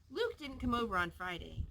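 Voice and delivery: plain reading voice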